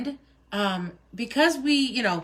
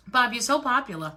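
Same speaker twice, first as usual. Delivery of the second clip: normal talking voice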